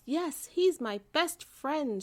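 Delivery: put-on a girl finding her bear, shouting; joyful shout. A narrator's reading. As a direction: call out happily while running. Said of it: "shouted Lisa".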